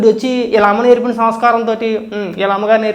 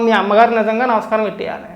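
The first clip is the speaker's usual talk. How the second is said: ordinary talk, nothing marked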